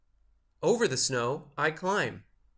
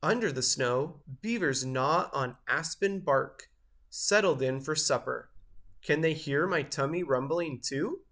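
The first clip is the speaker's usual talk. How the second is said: plain reading voice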